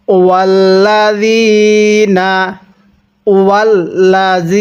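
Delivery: ওয়াল্লাযীনা with যী held one alif; slow recited. Shown as a demonstration. Teacher demonstrates the stretched ওয়াল্লাযীনা. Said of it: "জালের মধ্যে এক আলিফ টান দিব".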